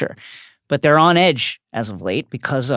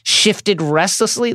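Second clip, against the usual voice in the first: ordinary talk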